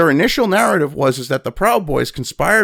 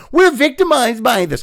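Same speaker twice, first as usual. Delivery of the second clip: mocking whiny victim voice; 8 semitones higher than usual.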